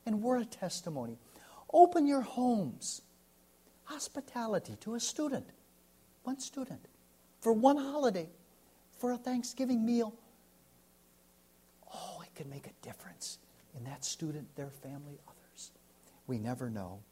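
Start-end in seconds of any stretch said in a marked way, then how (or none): 1.7-10.1 high, ringing call to open homes; fervent exhortation. Acted. Pastor urging the congregation with a high, ringing call. 11.9-15.7 much softer, near-whispered plea; hushed appeal. Acted. Pastor softly pressing the congregation about students' families. Preacher drops to hush.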